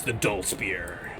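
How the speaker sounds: a tired wrestler's tagline, slow and low; low, slow, deflated announcer. Pretend. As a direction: drop the voice low and drag it, out of energy. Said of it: "Edge doing his taxes".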